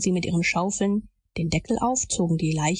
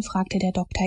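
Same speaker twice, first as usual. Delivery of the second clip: ordinary delivery, nothing marked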